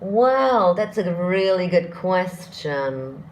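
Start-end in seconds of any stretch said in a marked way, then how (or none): none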